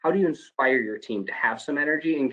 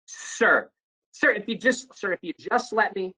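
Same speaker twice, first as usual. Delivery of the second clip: escalating interruption; 9 semitones higher, slower than usual. Retold or imitated. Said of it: "they start getting a little bit like".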